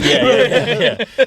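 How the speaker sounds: laughing. Real, not acted. Laughing agreement.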